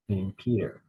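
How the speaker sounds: usual speaking voice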